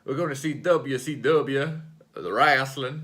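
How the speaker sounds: put-on slow, drawn-out southern accent. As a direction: say it like a Southern wrestling promo. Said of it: "in a southern accent".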